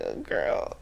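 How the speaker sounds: low breathy aside; breathy whisper. Real, not acted. Friend warning the character, whispered.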